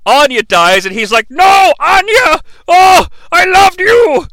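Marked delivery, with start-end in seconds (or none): 1.3-4.3 high, slow hammed-up wail of grief; mock anguished cry. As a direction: overplay the heartbroken scream. Mock grief at Anya's death.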